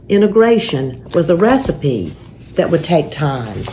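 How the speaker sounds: gentle, steady storybook reading; reading a picture book to children. A narrator's reading. Pastor reading a picture book to families, gentle storybook voice.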